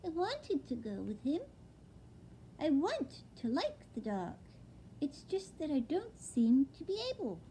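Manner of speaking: high, small owlet voice; a young owl, wide-eyed and dazed; little owlet voice. Acted. Owlet telling his father, high and small. Plop's voice in the story.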